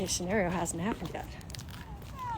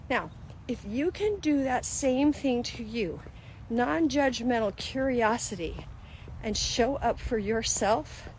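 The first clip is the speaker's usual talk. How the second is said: ordinary talk, nothing marked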